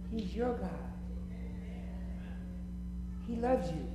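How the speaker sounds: hushed, tender pleading to each listener; soft altar call. Real, not acted. Preacher softly pleading with the congregation. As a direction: gently call them one by one.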